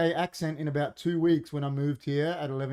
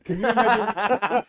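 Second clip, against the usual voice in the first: put-on Indian accent; 6 semitones higher, 6 dB louder, slower than usual. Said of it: "now that sounds Indian".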